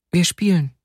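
put-on high little child's voice. A narrator's reading. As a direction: answer lightly as a small child. Narrator voices a playing child.

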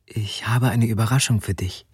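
usual speaking voice

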